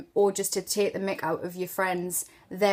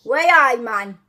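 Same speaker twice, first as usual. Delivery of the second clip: Geordie; 2 semitones higher, 8 dB louder, slower than usual. Shown as a demonstration. Mum modelling it for her daughter, broad Geordie. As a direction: exclaim it in full Geordie. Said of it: "you say I".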